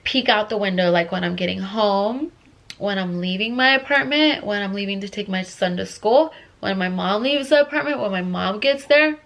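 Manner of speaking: ordinary talk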